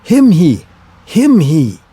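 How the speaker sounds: loud, pleading wordless grunts; a mute friend begging; urgent grunting; insistent grunt. Acted. Mute giant Harry grunting.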